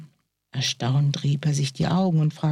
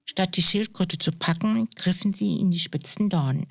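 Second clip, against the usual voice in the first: ordinary delivery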